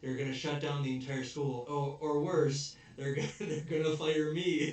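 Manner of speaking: a vice principal panicking about getting fired; mock panic. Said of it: "he's freaking out about all this".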